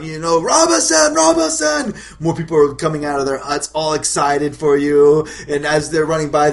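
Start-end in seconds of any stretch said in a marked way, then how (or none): 0.4-1.9 high, loud mock scream; hammed-up high-pitched cry; villagers screaming out. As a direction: call the name out like an excited crowd. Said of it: "screaming out".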